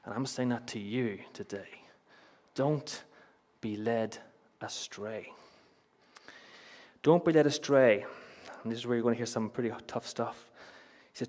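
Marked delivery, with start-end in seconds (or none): 2.5-5.3 slow, broken by pauses, falling softer; deliberate warning that sinks to quiet; solemn direct warning. Real, not acted. Warning addressed to the congregation. 7.0-8.0 louder, higher, in one push; raised, urgent preacher's warning; emphatic warning. Real, not acted. Warning repeated forcefully.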